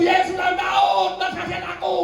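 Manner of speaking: crying out. Acted. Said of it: "dia teriak terus".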